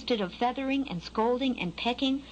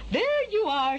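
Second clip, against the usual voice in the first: high, drawn-out motherly trill; 9 semitones higher, 3 dB louder, slower than usual. A narrator's reading. Mother bird finding Skylark on the ground, trilling. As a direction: sing it out on spotting your child. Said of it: "she trilled".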